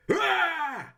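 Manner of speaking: high falsetto mock cry; mock shriek. Critic mocking Marv, high mock shriek.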